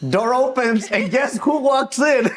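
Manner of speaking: laughing through the words; giggling, high and amused. Real, not acted. Laughing while telling awkward story.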